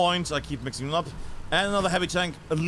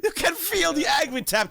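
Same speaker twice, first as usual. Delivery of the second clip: laughing; 8 semitones higher than usual. Real, not acted. Laughs watching the player.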